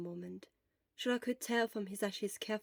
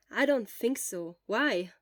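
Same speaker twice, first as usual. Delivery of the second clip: slow, louder thoughtful reply; lightly voiced friend; Hisashi's voice; 7 dB louder, slower than usual.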